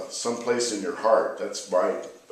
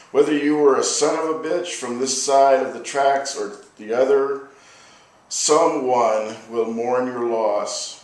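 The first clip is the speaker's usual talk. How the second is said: poetry reading; 3 semitones higher, 4 dB louder than usual. Acted. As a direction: let each line land like an elegy. Reads second poem aloud.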